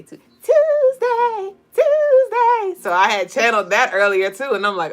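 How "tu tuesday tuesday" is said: high, slow excited squeal; Norbit squealing. Retold or imitated. Streamer quoting Norbit squealing with excitement. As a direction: stretch it out high and giddy. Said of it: "he was like".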